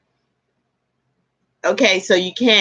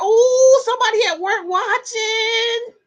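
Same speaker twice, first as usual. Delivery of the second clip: playful tease; 12 semitones higher than usual. Real, not acted. Teasing a viewer watching at work.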